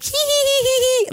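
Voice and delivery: tiny squeaky tee-hee giggle; imitating the tour guide's squeaky laugh; little giggle. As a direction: do his little giggle, way up high. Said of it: "like, little laugh".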